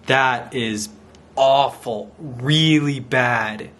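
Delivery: plain reading voice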